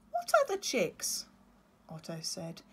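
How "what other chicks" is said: puzzled chick. Acted. Otto voiced.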